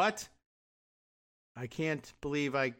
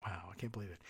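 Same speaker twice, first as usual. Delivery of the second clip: much softer and lower, dry sarcastic aside; deadpan sarcasm; 7 semitones lower, 13 dB quieter, faster than usual. Real, not acted. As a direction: mutter it with mock amazement.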